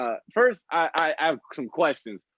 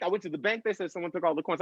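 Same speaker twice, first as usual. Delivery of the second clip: normal talking voice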